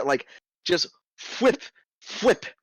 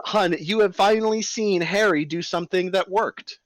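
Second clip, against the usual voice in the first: ordinary talk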